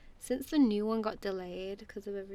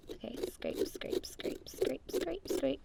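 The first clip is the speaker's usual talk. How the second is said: whispered scrape; 6 semitones higher, slower than usual. Real, not acted.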